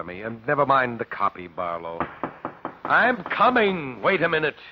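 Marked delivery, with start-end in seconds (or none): 2.8-4.6 higher, slow call toward the door. Acted. Professor calls out to the visitor at the door. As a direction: call it out to whoever's knocking.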